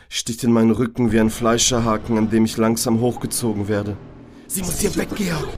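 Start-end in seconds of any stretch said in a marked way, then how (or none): 4.5-5.5 angry inner voice. Acted. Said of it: "spricht die wütende Stimme in meinem Kopf".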